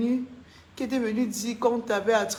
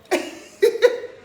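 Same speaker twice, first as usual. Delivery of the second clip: loud unvoiced burst of laughter; breathy laugh; 11 semitones higher, 6 dB louder than usual. Real, not acted. Laughing at a viewer's comment, loud unvoiced laugh.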